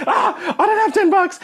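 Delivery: high, slow squeak of panic with a laugh; hammed-up flustered squeal; mock panic. Caught without cash in front of Myron, mock panicked squeal. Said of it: "And I'm freaking out".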